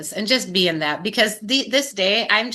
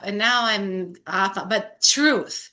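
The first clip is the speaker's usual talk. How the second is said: ordinary talk, nothing marked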